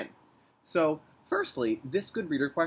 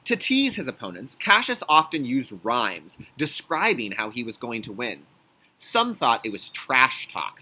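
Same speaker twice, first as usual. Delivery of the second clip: louder storybook reading; reading to children; 5 dB louder than usual. Acted. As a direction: read it like a picture book for kids. Reads the picture book aloud.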